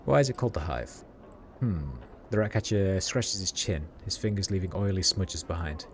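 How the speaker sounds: normal talking voice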